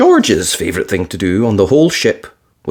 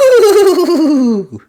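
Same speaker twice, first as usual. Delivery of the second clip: put-on high, piglet-girl voice; bright little girl gasp; young girl's delighted ooh; Peppa voice; 4 dB louder than usual. A narrator's reading.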